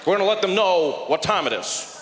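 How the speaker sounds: halting presidential address with emphatic bursts; hesitate, then declare it like a statesman; Barack Obama impression. Comic plays president vowing action, halting statesman impression. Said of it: "Barack Obama, he was there, he's like".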